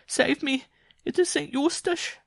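very high, loud cry of alarm; desperate cry. A narrator's reading. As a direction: shriek for help as a panicked woman. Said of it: "Jean-Marie cried".

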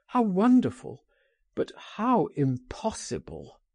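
high on "wonderful", dropping into regret; wistful cry. A narrator's reading. Friend answers an invitation to flee to France, a wistful cry. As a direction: exclaim with wonder, falling away at the end. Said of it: "he cried".

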